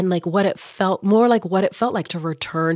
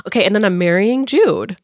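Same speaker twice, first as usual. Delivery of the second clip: normal talking voice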